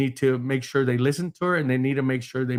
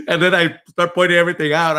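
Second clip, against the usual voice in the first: laughing; 4 semitones higher, 7 dB louder than usual. Real, not acted. Laughing at own story.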